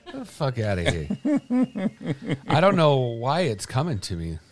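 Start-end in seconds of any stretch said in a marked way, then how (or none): 0.0-2.5 slow, drawn-out line broken up by laughter; laughing. Real, not acted. Cohost echoing the bedtime quote, laughing. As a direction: laugh the words out slowly.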